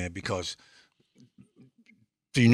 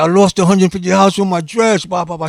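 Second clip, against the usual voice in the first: put-on high, loud complaining girl voice; retelling the girl's complaint, high and whiny; upset girl; 9 semitones higher, 6 dB louder than usual. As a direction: play the girl whining about her dress money. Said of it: "So she said".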